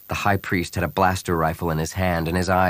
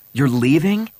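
higher, startled rising question; blurted surprise; 6 semitones higher, 4 dB louder than usual. A narrator's reading. Han to the departing bounty hunter, blurting in surprise. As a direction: blurt it out in disbelief. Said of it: "he blurted".

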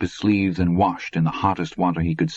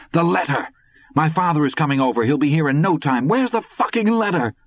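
high, frantic urgency, rising at the end; panicked demand; 10 semitones higher than usual. A narrator's reading. Panicked demand before father arrives.

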